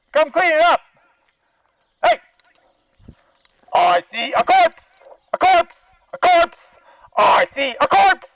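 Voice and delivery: ordinary talk, nothing marked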